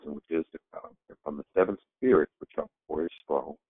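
normal talking voice

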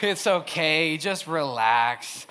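low, very slow mock-soothing drawl; calm voice. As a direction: imitate an utterly unbothered friend, stretching the words out. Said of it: "such a calm voice".

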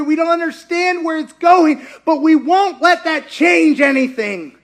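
loud, high, fervent proclamation; preaching at full force. Acted. Preacher crying out to the congregation, loud and high. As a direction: preach it out with rising fervor.

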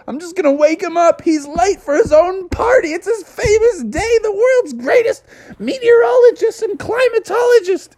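very high, earnest explaining voice; high cartoon bear. Bubby explains.

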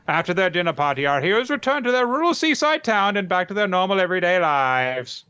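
put-on high, brisk old-timey announcer voice; old newsreel narrator. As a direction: read it like a vintage newsreel narrator. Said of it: "to reason to offend an old-timey voice use".

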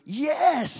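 high loud whoop of joy; thrilled yes. Retold or imitated. Son hearing about the Sonic movie, overjoyed. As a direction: leap at the news. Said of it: "goes through the roof".

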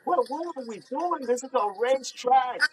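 high, hyped boasting; amped bragging. Real, not acted. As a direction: brag like a speed demon. Bragging about his speed, amped.

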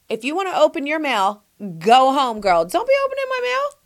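high, punchy mock scolding; sassy telling-off. Host role-plays telling a friend to go home, sassy. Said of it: "That's what I would say".

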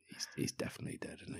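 whispered comic aside; hushed aside. Real, not acted. Podcaster undercutting his apology to the temple master, whispered aside. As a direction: drop to a quick whisper.

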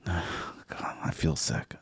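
soft, disgusted whisper. Real, not acted. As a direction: whisper it, queasy. Disgusted after the ad.

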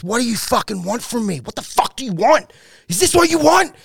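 desperate angry demand. Retold or imitated. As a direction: cry out in exasperation, climbing to a peak. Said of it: "And then he goes".

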